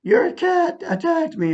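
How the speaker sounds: high, slow complaint imitating a screamer; drawn-out, high-pitched mock outcry; mock screaming. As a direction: mimic her screamed accusation in a high voice.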